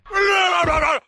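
high, loud shrieking cackle; high shrieking laugh. Retold or imitated. Retelling the doctor's verdict, shrieking laugh. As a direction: shriek it out like a siren. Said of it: "That's the sound of it".

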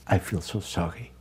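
a hushed whisper of remorse; whispered apology. Retold or imitated. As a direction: breathe it out as a whisper. Remorseful prisoner quoted softly.

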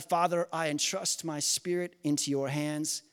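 usual speaking voice

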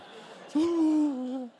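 long, high sigh of a hurt dad. Acted. Dad sighs after his teenager shuts him out, long high sigh. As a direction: let out a long wounded sigh.

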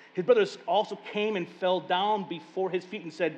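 ordinary talk, nothing marked

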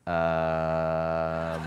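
low, drawn-out hesitant hum; reluctant hesitation. Retold or imitated. Sick woman unsure about a pastor's visit, reluctant. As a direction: stall on one long low note. Reluctant reply to prayer offer.

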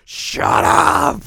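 high, full-force acted shriek; yelling. Teacher silencing Azusa's playing, acted yell. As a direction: imitate a teacher screaming for silence. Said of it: "Why did you yell at her?".

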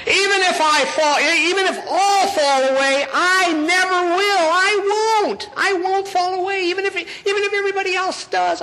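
high, insistent overprotesting disciple; hammed-up emphatic protest; Peter. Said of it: "Peter says".

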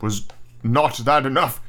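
slow, mildly fierce demand. A narrator's reading. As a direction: demand it slowly, with restrained anger. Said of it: "he demanded fiercely".

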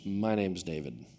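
low, slow, quiet reply; blank deadpan. Retold or imitated. Aloof roommate returns the introduction, blank deadpan. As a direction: answer with no interest at all. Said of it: "just blank".

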